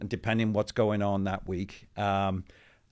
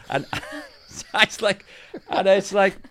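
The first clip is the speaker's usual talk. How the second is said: laughing; 9 semitones higher, 5 dB louder than usual. Real, not acted. Laughing after retold heckle.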